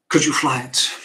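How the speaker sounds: loud, scoffing question; father's loud, incredulous brush-off; dismissive retort. Acted. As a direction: throw it back loudly, dismissing it out of hand.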